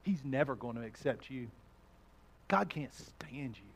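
low, soft, sinister tempter; enemy's voice. Acted. Preacher voicing the enemy to the congregation, low and soft. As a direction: murmur the lies low and soft. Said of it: "So his voice changes".

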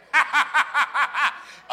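hearty burst of laughter; loud laugh. Real, not acted. Preacher laughs with the congregation, loud laugh.

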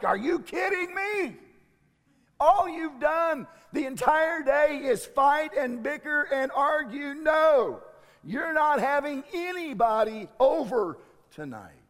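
loud, high, fed-up scolding; a mother at the end of her rope snapping back; exasperated mom. Retold or imitated. Mom refusing a sleepover after a day of fights, loud exasperation. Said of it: "And you're like".

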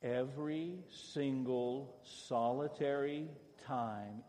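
drawn-out, each word weighted; every word of "Every single solitary time" stretched out; slow group recitation. Shown as a demonstration. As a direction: chant it slowly with the congregation. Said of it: "Say that with me".

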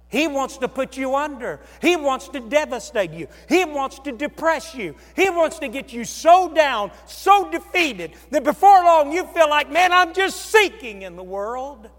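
preaching build. Acted. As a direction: climb to full-force preaching.

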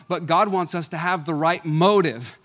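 ordinary delivery

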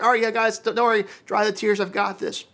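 mock carefree Jesus dismissing grief; breezy reassurance. Preacher imitating an untroubled Jesus to the mourners, mock breezy. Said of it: "shouldn't he just kinda be like".

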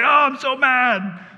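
drawn-out pretend fury; mock mad. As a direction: play your own temper for laughs. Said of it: "I'm like".

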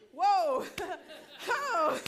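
stunned whoa, then laughing; surprised laugh. Retold or imitated. Hearing the pastor ask her to come to Ohio, surprised laugh. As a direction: react with laughing disbelief. Shocked reaction to pastor offer.

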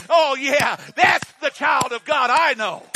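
loud triumphant shout; heaven cheering. Pretend. Pastor voicing heaven cheering a child of God, loud triumphant shout. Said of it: "all of heaven will stand up and say".

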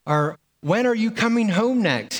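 a son imagining his dad shouting at him; mock yelled texts. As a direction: read the texts as if dad were yelling. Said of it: "like, yelling at you".

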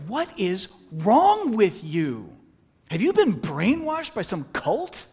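high, loud disbelief; appalled culture voice. Acted. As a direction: berate them for their odd beliefs. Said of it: "You see, that's our culture".

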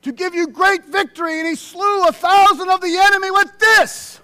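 loud, high preaching cry. Real, not acted. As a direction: proclaim the victory at full force. Preaching at full force.

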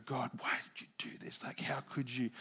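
hushed, breathy whisper to God; a moping whisper asking God why; whispered complaint. Retold or imitated. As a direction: whisper it like a private grumble. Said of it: "I'm still standing there go".

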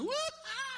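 high whoop of joy; a quick high woo-ha like someone in the pews; mock worship shout. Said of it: "Somebody will shout out".

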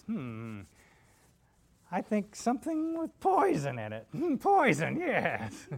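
high-pitched, drawn-out witch voice; Wicked Witch. Acted. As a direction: scheme it slowly as a wicked witch. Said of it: "the Wicked Witch of the West".

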